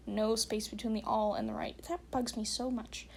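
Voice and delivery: ordinary talk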